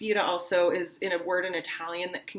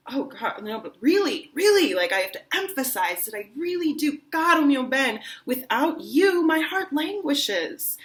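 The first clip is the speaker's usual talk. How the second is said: laying it on; 5 semitones higher than usual. Shown as a demonstration. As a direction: plead as hard as you can. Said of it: "really lay it on as hard as you can".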